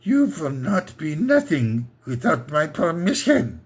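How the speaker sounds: stern mock Austrian accent; a hint of a stern Austrian dad; mock Austrian father. Daddy forbidding young Arnold, mock Austrian accent. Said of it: "Daddy turns to young Arnold and goes".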